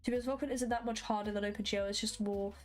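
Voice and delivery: normal talking voice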